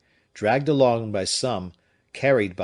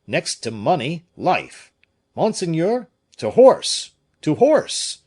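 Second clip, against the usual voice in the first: climbing, ever louder and higher call; urgent shout; 7 semitones higher, 8 dB louder, slower than usual. A narrator's reading. Pelisson urges his master to ride, in an urgent shout. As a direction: rouse him to flee now.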